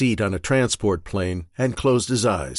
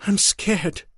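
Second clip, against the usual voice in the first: higher, pleading wife close to tears; Marion, desperate wife; 9 semitones higher than usual. Acted. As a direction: beg him for a shred of hope. Marion head in hands.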